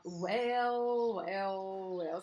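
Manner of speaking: slow, drawn-out villainess gloating; Disney villainess. Acted. Inner critic arriving like a Disney villainess, drawn-out gloating. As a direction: stretch each word like a cartoon villainess. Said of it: "aquelas vilãs da Disney".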